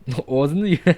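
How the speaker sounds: put-on amused echo of a Swedish accent; imitated Swedish accent. Listener mimics the Swedish accent.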